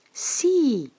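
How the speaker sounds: put-on a high, eager yes from a little girl; excited girl. A narrator's reading. Angela jumping up to answer the teacher, high and eager. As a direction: burst out with sudden courage.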